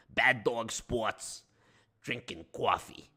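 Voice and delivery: put-on lightly held New York wiseguy accent; a hint of Brooklyn mobster, grumbling. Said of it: "the New York accent".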